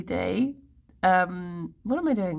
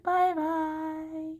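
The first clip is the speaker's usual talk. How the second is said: high, soft, drawn-out farewell; soft singsong sign-off; singsong goodbye; 7 semitones higher, 4 dB quieter than usual. Real, not acted. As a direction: stretch the goodbye out lightly on a high note. Playful repeated sign-off.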